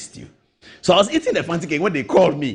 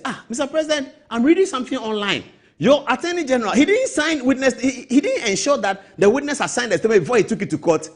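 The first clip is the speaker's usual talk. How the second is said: higher-pitched mock caller telling tales; caller reporting to the president; 9 semitones higher than usual. Caller tells the president bad news, raised voice. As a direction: play the worried informant, voice up high. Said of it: "then they call you, say".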